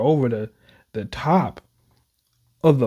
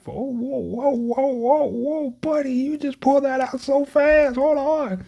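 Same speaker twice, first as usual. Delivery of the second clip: startled; 13 semitones higher than usual. Real, not acted. Gamer reacts to sudden gun scene.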